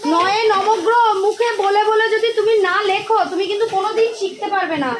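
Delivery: mother warning daughter she will never learn; stern scolding. Real, not acted. Mother scolding daughter over lessons.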